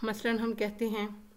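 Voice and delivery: normal talking voice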